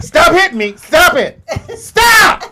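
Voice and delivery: playful squealing stop; laughing stop. Real, not acted. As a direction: beg them to stop through laughter. Being playfully hit, laughing.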